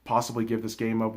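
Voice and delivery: retold panic. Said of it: "I was panicking".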